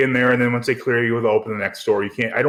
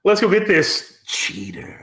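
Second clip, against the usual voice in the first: mock thug line; 5 semitones higher, slower than usual. Cohost mocks the gangsters' line.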